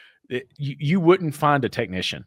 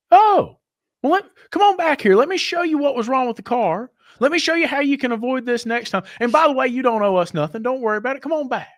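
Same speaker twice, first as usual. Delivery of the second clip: imitating the mechanic, much higher, overly friendly and eager; hammed-up sucking up to the boss, much higher; mock sweet-talking; 10 semitones higher, 4 dB louder than usual. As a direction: rude mechanic suddenly fawning over the owner, mock eager and much higher. Rude mechanic turns overly accommodating.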